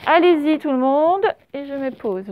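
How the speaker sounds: normal talking voice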